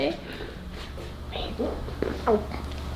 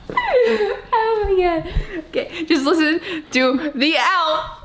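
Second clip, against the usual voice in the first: hiccup giggles; 4 semitones higher than usual. Real, not acted. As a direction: crack up between hiccups. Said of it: "Every time I laugh, I hiccup more".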